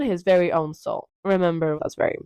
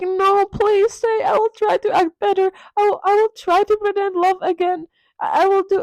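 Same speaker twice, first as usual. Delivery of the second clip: mock desperate pleading; 12 semitones higher, 4 dB louder than usual. Sybil begging Dorian to stay, high hammed-up desperation. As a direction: plead like a melodrama heroine.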